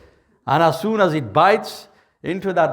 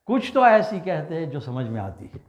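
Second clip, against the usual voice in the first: quick scoff; 4 semitones lower, 4 dB quieter, much faster than usual. Acted. Small fish scoffs again, quick.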